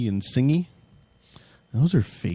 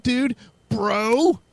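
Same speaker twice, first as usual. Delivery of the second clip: higher pitched dopey dude voice; mocking metal fans in a higher dopey voice; metalhead bro talk; 13 semitones higher than usual. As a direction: play a stoner metalhead. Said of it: "I'm talking like a real metalhead".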